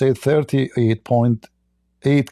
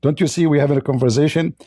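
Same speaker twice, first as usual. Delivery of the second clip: ordinary talk, nothing marked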